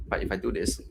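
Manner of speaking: side-on mic, dull distant sound. Shown as a demonstration. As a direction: say it past the mic, not into it. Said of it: "like this... on the side".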